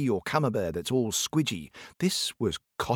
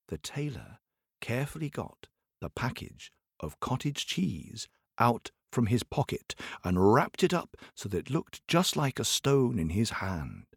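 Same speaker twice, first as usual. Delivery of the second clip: plain reading voice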